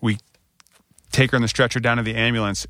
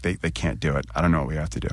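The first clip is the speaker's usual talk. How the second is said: choked up; 6 semitones lower, 3 dB quieter, faster than usual. Retold or imitated. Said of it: "now I'm sobbing".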